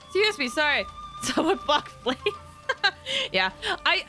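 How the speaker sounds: ordinary talk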